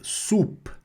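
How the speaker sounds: high "Soupe" with a soft, light p; discreet final consonant. Shown as a demonstration. Teacher repeating for students, "Soupe" with a discreet p. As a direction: close "Soupe" on a barely released p. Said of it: "bem discretamente pronunciada".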